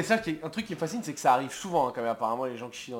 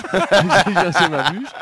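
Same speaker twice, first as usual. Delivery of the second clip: laughing; 4 semitones higher, 8 dB louder, slower than usual. Real, not acted. Repeats the line laughing, drawn out.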